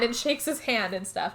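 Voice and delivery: normal talking voice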